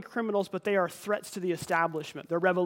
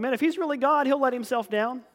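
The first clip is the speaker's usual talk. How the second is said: higher, louder mocking taunt; mocking jeer; 5 semitones higher, 4 dB louder than usual. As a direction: sneer it like a jeering crowd. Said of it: "they mock him, going".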